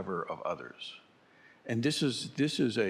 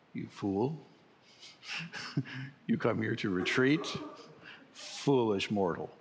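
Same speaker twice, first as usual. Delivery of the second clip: grand villain voice, amused; mock evil overlord taunting; booming echoing villain monologue. Teacher mock-plays a villain at retreat.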